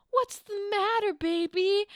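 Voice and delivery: high, loud mock concern; hammed-up sweetheart fussing; doting lover. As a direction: coo it loudly like an overdone boyfriend. Acting Brutus as doting lover.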